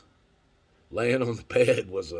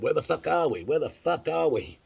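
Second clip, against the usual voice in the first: lost tribe calling out; 7 semitones higher than usual. Retold or imitated. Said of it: "up on top of these mountains going".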